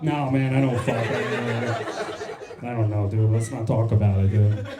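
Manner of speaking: low, subdued mock reluctance; sheepish dodging guy. Guy asked if he has sex, low sheepish mumbled denial. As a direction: drop the voice and back away from the topic.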